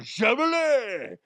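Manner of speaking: high, slow, loud silly yell of the name; imitating Sandler's shout. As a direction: squeal it like a goofy kid. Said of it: "He's like".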